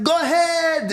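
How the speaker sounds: high, drawn-out heckler's call; heckling call. Acted. Imitating a friend yelling at the host, heckling call. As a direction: call it out across a theater.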